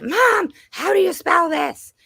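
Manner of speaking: loud, high-pitched mock yell for Mom; imitated frustration. Mom imitates daughter stuck on a spelling, frustrated yell. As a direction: mimic an exasperated child calling out. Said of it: "she'll get really frustrated and she'll say".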